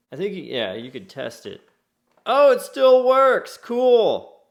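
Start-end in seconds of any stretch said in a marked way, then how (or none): 2.2-4.4 thrilled surprise, loud and high; delighted, pops out loud; excited. Real, not acted. Old toy works on test.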